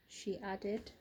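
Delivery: plain reading voice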